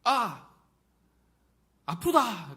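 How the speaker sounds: loud, high acted yelp; cry of pain. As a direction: yell as if just hit. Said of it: "누가 저 때리면요".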